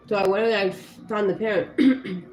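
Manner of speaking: normal talking voice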